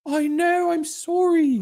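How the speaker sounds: put-on very high, apologetic English girl voice; girl's English accent. Voicing the girl's apology in a high English accent. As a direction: squeak out a sorry in an English accent. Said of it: "I'll do the English accent for the girl".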